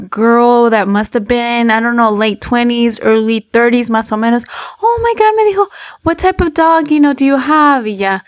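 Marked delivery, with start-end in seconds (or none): 4.8-7.8 high-pitched imitation of a gushing stranger; eager, squealy retold question; excited stranger. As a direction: play the over-friendly dog lover. Chatty stranger voiced asking about dog.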